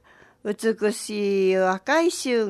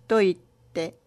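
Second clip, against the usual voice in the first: ordinary delivery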